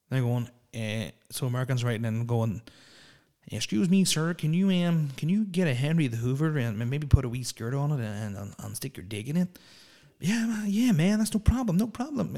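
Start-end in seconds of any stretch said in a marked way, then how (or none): 3.5-9.6 hammed-up American fan asking politely; bad American accent. Fans writing in, asking in a bad American accent. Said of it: "really bad American accents". 10.2-12.4 much higher, mock eager American; hammed-up American saying yes to everything; bad American accent. Performers agreeing to requests, in a bad high American accent. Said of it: "really bad American accents".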